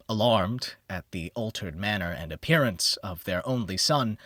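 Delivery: plain reading voice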